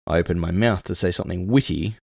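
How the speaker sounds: ordinary delivery, nothing marked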